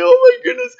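put-on very high, loud squeal of a child; tearful child. Boy wiping tears after his climb, high tearful squeal. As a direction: imitate an overwhelmed little boy, squealing. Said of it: "he's wiping the tears".

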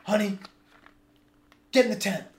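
lightly acted alarmed dad; voicing the father. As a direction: order the family into the tent. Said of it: "and he says".

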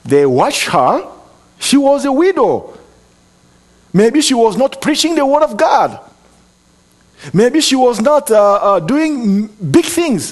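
high, loud preacher's cry; fiery sermon delivery, slowing on the last words; preaching at full force. Acted. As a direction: lift the congregation with a loud, high call.

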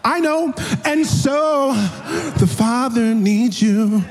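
put-on wife calmly brushing off the crying baby; lofty wife. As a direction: float above the crying baby. Wife answering him.

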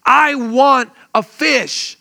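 put-on slow, high, drawn-out child's plea to a father; child begging his father for a fish, slow and high; pleading child. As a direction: stretch it out like a little kid asking for a fish.

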